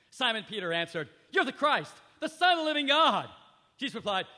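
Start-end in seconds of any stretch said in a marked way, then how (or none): 1.3-3.3 higher, louder proclamation; bold confession. Acted. Peter answering Jesus, loud eager proclamation. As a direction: declare it with conviction.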